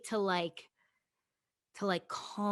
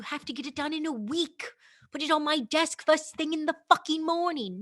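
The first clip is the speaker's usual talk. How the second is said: much higher, louder demanding boss voice; mock teacher barking a deadline; bossy teacher; 8 semitones higher, 5 dB louder than usual. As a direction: bark the order like a strict teacher.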